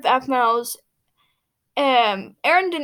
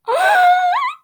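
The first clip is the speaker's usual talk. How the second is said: shocked gasp, very high and loud; sudden squeaky gasp of surprise; high-pitched gasp; 8 dB louder than usual. Real, not acted. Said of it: "My voice just went really high-pitched there".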